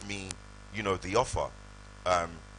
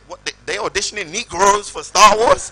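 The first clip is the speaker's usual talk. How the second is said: much higher and louder, stunned yell; disbelieving shout; 12 semitones higher, 17 dB louder than usual. Retold or imitated. Disbelieving shout at agent's news.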